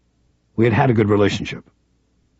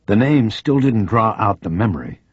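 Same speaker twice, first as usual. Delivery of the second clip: ordinary delivery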